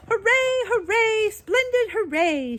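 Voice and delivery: very high, loud cheering; shrill cry. A narrator's reading. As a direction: cheer shrilly as a little old woman. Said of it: "cried in her shrill little voice".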